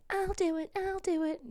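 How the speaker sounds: very high, squeaky pipe-up; mock eager volunteer. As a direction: beg to be picked in a tiny high voice. Imitates own eager volunteering as pupil.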